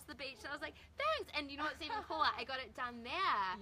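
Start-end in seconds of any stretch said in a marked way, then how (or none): 1.0-1.3 much higher, squeaky thanks; chirpy thanks. Retold or imitated. Flattered reply.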